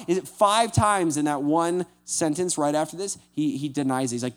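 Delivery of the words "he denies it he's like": ordinary delivery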